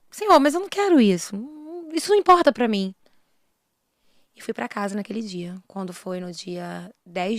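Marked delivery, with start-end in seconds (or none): 0.1-2.9 raised, higher-pitched objection to God; retold plea in a raised voice; pleading protest. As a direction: protest louder, as a mother refusing.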